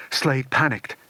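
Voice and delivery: ordinary delivery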